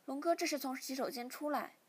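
ordinary delivery

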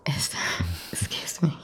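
flustered whisper. Acted. Flustered after kissing.

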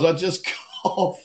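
breaking into laughter mid-sentence; real amused laughter. Real, not acted. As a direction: laugh through the punchline. Amused by supermarket cough joke.

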